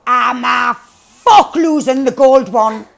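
loud, slow, indignant yell; defiant shout. Real, not acted. Refusing to lose the gold gun, defiant shout. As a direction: bellow it out, refusing to give in.

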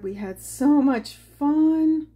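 slow, high, loud and drawn out; gushing. Real, not acted. Telling viewers about ziplining, gushing slow and high. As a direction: stretch every word with delight.

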